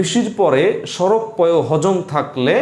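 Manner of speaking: slow, spaced-out recitation of the spelling rule; teacher drilling the mnemonic at half speed; slow rule recital. Shown as a demonstration. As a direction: spell out the rule word by word, slowly. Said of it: "গত ক্লাসের পড়া আমি একটা নিয়ম বলেছিলাম".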